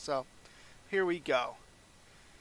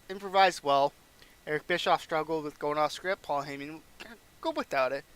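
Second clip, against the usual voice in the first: normal talking voice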